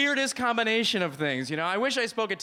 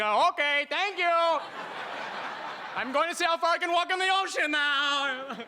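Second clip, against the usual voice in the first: higher voice, mock flustered foreigner; committed fake tourist; 10 semitones higher than usual. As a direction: stay in the silly character while backing off. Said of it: "committed to the character".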